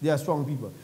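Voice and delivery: low, soft aside on "They are strong people". Shown as a demonstration. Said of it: "That's just a by the way".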